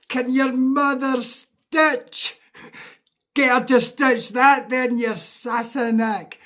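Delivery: high, loud mock scream at the Englishman; screaming uncle. Uncle yelling at the downed English fan, loud, high mock fury. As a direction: bellow it slow and high like a brawling Scot. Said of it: "starts screaming at him like".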